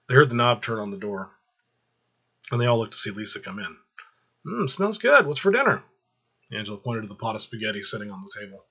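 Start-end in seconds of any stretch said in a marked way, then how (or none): 4.4-5.8 put-on higher, louder bright young woman; Lisa's voice. Lisa walking in, cheerfully greeting the family at dinner. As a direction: voice the girlfriend, light and cheerful.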